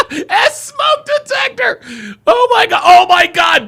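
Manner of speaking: high, loud laughing shout; howling with laughter. Real, not acted. Streamer howling at a viewer's joke, loud laughter. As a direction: lose it laughing.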